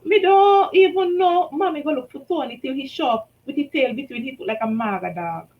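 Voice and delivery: plain reading voice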